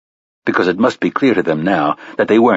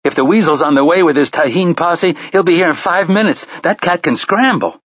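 urgent; 10 semitones higher than usual. A narrator's reading. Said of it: "in an urgent voice".

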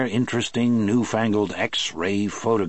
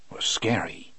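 whispered; 4 dB quieter than usual. A narrator's reading. Narrator's dramatic aside.

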